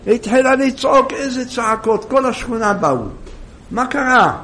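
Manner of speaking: normal talking voice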